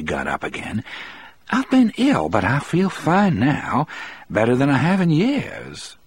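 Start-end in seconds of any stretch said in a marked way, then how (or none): 1.5-6.0 high-voiced woman, cheerily reassuring; cheerful recovered wife. A narrator's reading. Melinda tells the visitors she feels fine now, cheerful. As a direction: voice her newfound health, pitched high.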